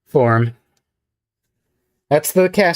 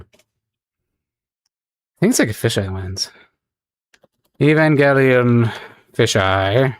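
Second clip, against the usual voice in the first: out of breath; slower than usual. Real, not acted. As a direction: talk while catching your breath. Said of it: "Forgive me if I sound out of breath now".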